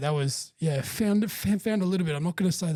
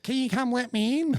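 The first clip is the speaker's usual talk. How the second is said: mock mate voice; 9 semitones higher than usual. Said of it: "He goes".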